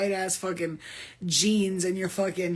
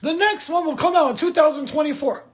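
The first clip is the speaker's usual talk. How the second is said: higher, louder announcer voice; imitating the network announcing the next season; mock official announcement; 6 semitones higher, 7 dB louder than usual. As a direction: deliver it like a studio press statement. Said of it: "they're like".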